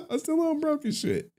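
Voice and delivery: squeaky high laugh through the words. Real, not acted. Streamer laughing at himself about being broke.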